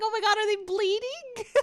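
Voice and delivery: imitating her own alarm; mock panic. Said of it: "I was like".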